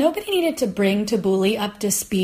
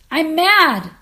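gasped exclamation; 6 semitones higher, 7 dB louder than usual. Retold or imitated. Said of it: "she gasped".

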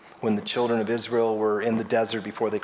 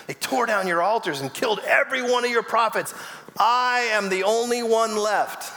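dramatic complaint; 9 semitones higher than usual. Acted. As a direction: plead your case, hammed-up and self-pitying. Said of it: "Oh, how we say that".

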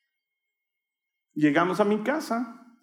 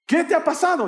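high, louder acted question; alarmed mother; 11 semitones higher, 5 dB louder than usual. Mother asking her son through the door, alarmed calling.

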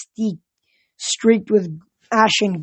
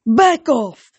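loud, raised warning; forceful command, pitched up; fierce yell; 5 semitones higher, 6 dB louder than usual. A narrator's reading. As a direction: try to sound fierce to scare them away. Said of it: "I yelled at the rats".

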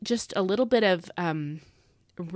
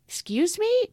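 indignant excuse me; 13 semitones higher, 3 dB louder than usual. Acted. Rejecting the claim God failed.

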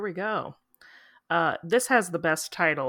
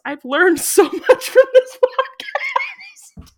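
laughing mid-sentence, high and slow; 11 dB louder, much slower than usual. Real, not acted. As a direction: break into laughter while reading. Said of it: "This is why I shouldn't cold read these".

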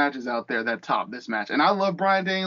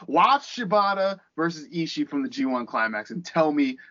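usual speaking voice

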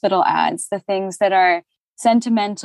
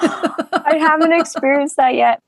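laughing; 8 semitones higher, 3 dB louder than usual. Real, not acted. Laughing at the sign-language joke.

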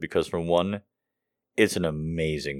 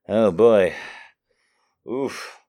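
ordinary talk, nothing marked

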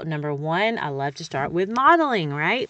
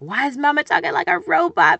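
put-on louder, puzzled child voice; kid's voice; 3 semitones higher, 7 dB louder than usual. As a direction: play the confused kid. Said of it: "They're gonna be like".

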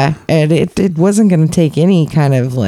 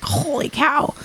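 high, soft amazed; high awed whisper; 12 semitones higher, 8 dB quieter than usual. Retold or imitated. Impressed by her psychology talk, soft high awe. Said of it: "I was just like".

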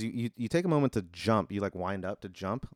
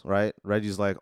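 ordinary talk, nothing marked

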